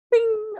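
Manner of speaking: high, bright ding of an idea; light bulb bing. Retold or imitated. Salesman marking his idea with a high bing. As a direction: squeak it like a bell going off. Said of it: "a light bulb's on".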